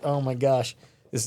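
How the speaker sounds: ordinary talk, nothing marked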